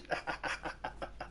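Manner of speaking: breathy cackle after a joke; laughter. Real, not acted. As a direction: burst out laughing at your own tease. Laughs after teasing a viewer.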